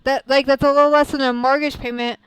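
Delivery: incredulous rant. Real, not acted. Streamer tells chat about rent, loud worked-up rant.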